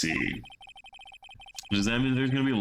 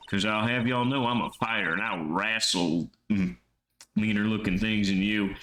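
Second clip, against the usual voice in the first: put-on Texan accent. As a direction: play the feisty Texan photographer. Texan character voice again.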